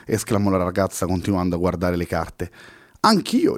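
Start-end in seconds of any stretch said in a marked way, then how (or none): 3.0-3.6 high, swaggering comeback; a lightly hammed-up swagger; cocky reply. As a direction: toss it back boastfully. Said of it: "rispose spavaldo Henry".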